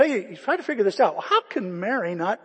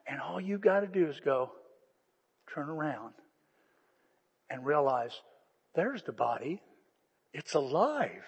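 soft tender invitation; 4 semitones lower, 7 dB quieter than usual. Acted. Gentle invitation to turn around.

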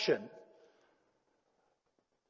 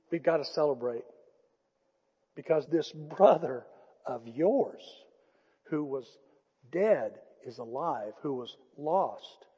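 slow and much softer, each phrase apart; hushed tender father; 5 semitones lower, 7 dB quieter, slower than usual. Acted. Father softly telling his son the lost brother is back, hushed. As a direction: let it fall gently, like a quiet blessing. Father's gentle closing words.

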